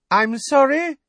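high, loud and prim. A narrator's reading. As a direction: call it out, pointedly loud. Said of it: "said Professor Umbridge loudly".

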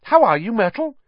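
high, forced cheerful greeting; falsely bright. A narrator's reading. Girl greets ghost she insulted, falsely bright. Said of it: "in a falsely bright voice".

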